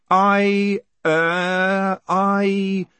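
high, drawn-out hesitation; stalling. Acted. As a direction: stretch each filler to buy time. Condemned man stalls for time.

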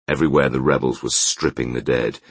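ordinary delivery

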